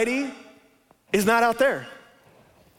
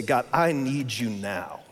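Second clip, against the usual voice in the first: lower, softer and slow, an urgent plea; earnest prayer; 8 semitones lower, 4 dB quieter, slower than usual. Acted. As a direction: pray it on your knees, low and slow. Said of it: "get on your knees and say".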